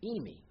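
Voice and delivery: "eimi" said ee-mee, falling; Greek eimi. Shown as a demonstration. Preacher naming the Greek word, ee-mee. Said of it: "another Greek word".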